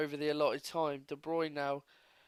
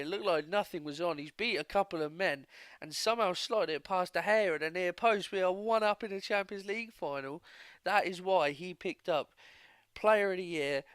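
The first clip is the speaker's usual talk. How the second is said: loud, higher-pitched goal celebration; excited goal commentary; 4 semitones higher, 7 dB louder than usual. Real, not acted. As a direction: burst into amazed, full-voiced match commentary.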